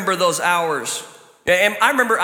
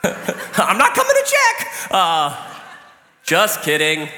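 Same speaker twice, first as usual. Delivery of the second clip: calling out joking; slower than usual. Retold or imitated. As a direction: call out then laugh it off. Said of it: "from the other room".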